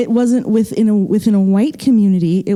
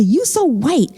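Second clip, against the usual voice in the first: high, swooping imitation of teasing classmates; exaggerated retold disbelief, pitch raised; mocking schoolmates; 5 semitones higher than usual. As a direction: mimic peers exclaiming at an outsider's speech. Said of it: "it was just like that".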